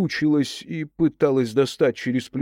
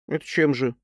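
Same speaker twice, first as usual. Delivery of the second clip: sullen, head-down question; sulky challenge; 3 semitones higher than usual. Acted. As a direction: ask it like a sulking teen. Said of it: "набычившись". (набычившись, угрюмо)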